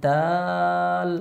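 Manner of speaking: দাল held very long, three alif lengths; drawn-out letter. Shown as a demonstration. Teacher drawing out দাল for the class. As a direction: stretch দাল out slowly. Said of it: "তিন আলিফ টানবো".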